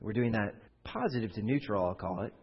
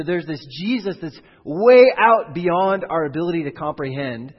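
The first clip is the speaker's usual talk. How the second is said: emphatic preaching; 4 semitones higher, 11 dB louder than usual. Real, not acted. Preacher stressing Jesus beyond comprehension.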